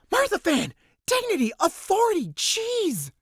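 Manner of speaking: high exasperated cry; exasperated outburst. Acted. Riho exasperated at Mirthafan, high outburst. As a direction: throw up your hands in exasperation. Mocking Mirthafan's stern manner.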